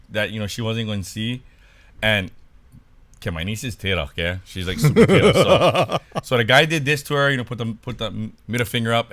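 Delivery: ordinary talk, nothing marked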